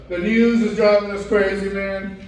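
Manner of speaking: ordinary talk, nothing marked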